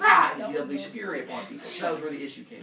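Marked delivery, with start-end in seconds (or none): 0.0-0.3 sudden high roaring yell; furious roar. Retold or imitated. Hades roars at people who keep talking to him. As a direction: roar out in fury at pestering people. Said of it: "He's like".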